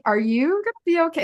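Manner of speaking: mock alarmed concern; concerned friend. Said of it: "They're like".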